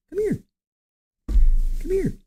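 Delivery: high coaxing pet voice. Real, not acted. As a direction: coax the kitty over. Calling the cat over.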